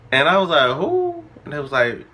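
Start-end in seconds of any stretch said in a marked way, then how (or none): 0.8-1.2 sharp high squeak of disbelief; shocked high "Who?". Retold or imitated. Girl asks who, she squeaks in shock. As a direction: caught off guard, pitch shoots up. Shocked reply in retold scene.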